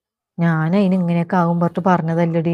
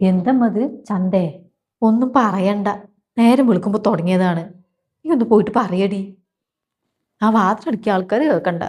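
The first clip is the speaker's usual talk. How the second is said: ordinary talk, nothing marked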